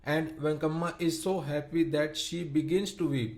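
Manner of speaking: plain reading voice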